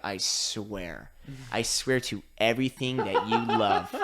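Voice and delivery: low mock-menacing oath; commissioner threat. Commissioner on phone to referee, low mock threat. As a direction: growl a fake threat down the phone. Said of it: "he was like".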